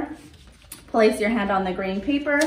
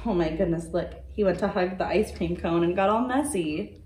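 storybook reading voice with character turns; reading to children. A narrator's reading. Librarian reading a dinosaur story aloud to children, gentle storytelling. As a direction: read it like a picture book for little ones.

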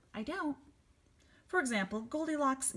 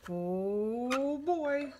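big booming papa dinosaur; loud booming voice; 3 semitones higher, 3 dB louder than usual. Acted. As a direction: boom it out like a giant. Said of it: "in his loud, booming voice".

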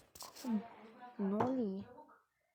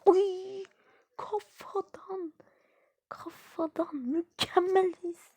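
drawn-out cry; 8 semitones higher, 4 dB louder, much slower than usual. Real, not acted.

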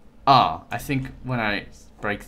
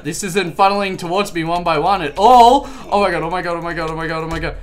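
rising panic; 6 semitones higher, 8 dB louder, faster than usual. Real, not acted. Overwhelmed by pirates.

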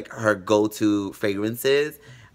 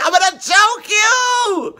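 much higher, louder, slow screaming threat; furious mother; 8 dB louder, slower than usual. Retold or imitated. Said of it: "she'll be like".

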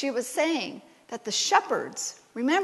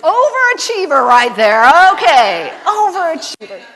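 laughing tease; 3 semitones higher, 12 dB louder than usual. Real, not acted. Teasing, laughing.

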